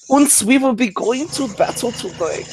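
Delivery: bad German accent. Imitating Hooper as a German, bad high German accent. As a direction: ham up a German warrior. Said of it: "my German accent's not that great".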